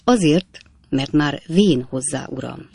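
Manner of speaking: plain reading voice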